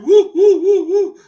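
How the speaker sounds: loud, high owl-like whoops; mock excited hooting. Reactor asking viewers "did you catch that", mock excited hooting. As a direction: hoot like an excited owl, laughing at him. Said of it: "are you an owl?".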